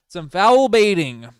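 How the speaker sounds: sudden loud, high call of foul baiting; loud callout. Real, not acted. Streamer calling out the star's foul baiting, loud. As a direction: bark it out, indignant.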